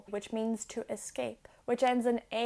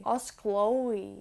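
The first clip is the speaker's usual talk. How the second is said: ordinary delivery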